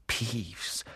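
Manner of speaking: hushed, very soft aside; whispered warning. A narrator's reading. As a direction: whisper it to the children behind you. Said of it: "flüsterte Percy den Erstklässlern zu".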